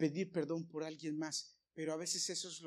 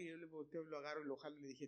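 ordinary talk, nothing marked

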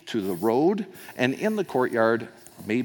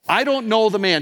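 impersonal denial; 11 semitones higher, 8 dB louder than usual. Acted. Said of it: "Doesn't even say Jesus. Makes it impersonal".

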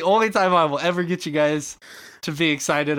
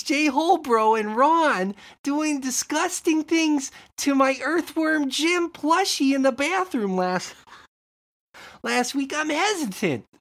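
pitched-up doubtful store owner; hesitant shopkeeper; 9 semitones higher than usual. Acted. Shopkeeper recalls bathroom incident.

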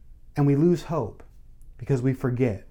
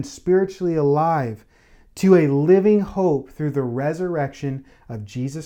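fervent preaching, pitch lifted, pressing the point; preaching at full force; 3 semitones higher, 4 dB louder than usual. Acted. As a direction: build the sermon to a joyful peak. Preacher at climax.

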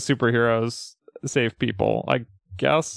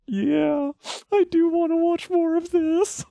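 much higher, tearful-eyed eager voice; moved viewer voice. Retold or imitated. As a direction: voice a moved fan asking for more. Imagined viewer quote, crying.

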